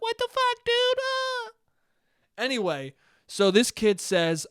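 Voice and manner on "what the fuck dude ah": very high squeaky mock yell; young kid angry in the moment, squeaking; mock kid shriek. Acts young angry self.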